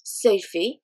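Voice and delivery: usual speaking voice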